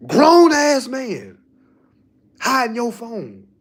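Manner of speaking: drawn-out, high and loud mock outrage; incredulous scolding shout. Grown man caught hiding from his supervisor, loud mock outrage. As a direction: belt it out slowly in disbelief.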